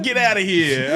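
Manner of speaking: high put-on quote voice; mock local "get outta here"; imitated local. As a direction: toss it off in a New York voice.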